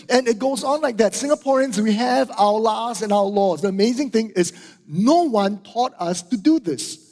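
ordinary talk, nothing marked

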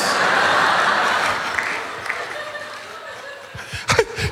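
high squealing laugh of disbelief; shrieking laugh. Real, not acted. Pastor shrieks with laughter at his daughter's lecture. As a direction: laugh in high-pitched exasperation.

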